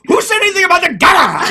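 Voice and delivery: loud high shout of the line. Retold or imitated. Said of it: "one day I just went".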